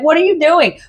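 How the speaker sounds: high, mock-baffled question; lightly exasperated pet owner; bewildered scolding. Owner to a cat flying through the house, baffled.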